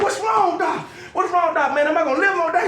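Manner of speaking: high, urgent mock panic; alarmed pleading. Patient asking doctor if he will live, mock alarm. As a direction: demand an answer in comic dread.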